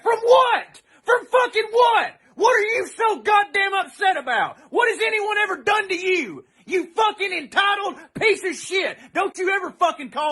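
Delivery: high, shouted outrage; real exasperated yelling; furious. Real, not acted. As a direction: demand an answer, really furious. Furious rant at the rioters.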